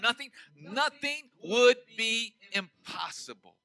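slow, deliberate nothing, nothing; stress on nothing. Shown as a demonstration. Preacher underlining scripture for the church, slow stress on "nothing". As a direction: lean on each "nothing" slowly. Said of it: "underline that word".

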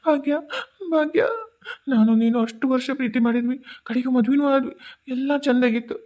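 calling her name through tears; tearful. Acted. Husband mourning his wife Bhagya, calling her name through tears. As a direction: cry out for the wife you lost.

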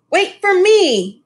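high, slow call after someone; mother calling to wait for her. A narrator's reading. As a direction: call out to the husband ahead. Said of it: "called Missus Lee".

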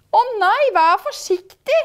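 high, loud anxious plea; alarmed. A narrator's reading. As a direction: cry out a worried warning. Prince alarmed for his crown.

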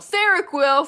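high, loud gleeful shout of Seroquel!; excited shout. Real, not acted. Reacting to chat's Seroquel, a gleeful loud shout. Shouting back the drug's name.

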